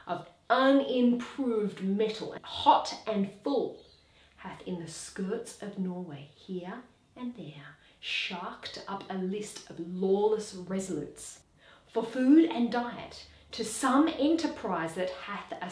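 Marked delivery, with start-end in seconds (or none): 4.4-11.4 conspiratorial whisper; dropped to a whisper. Acted. Horatio tells the watch of Fortinbras's raiders, whispering. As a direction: whisper the plot like a secret. Recounting Fortinbras's secret muster.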